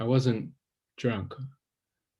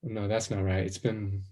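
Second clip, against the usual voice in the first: ordinary talk, nothing marked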